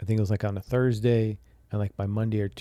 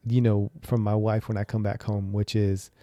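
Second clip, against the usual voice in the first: usual speaking voice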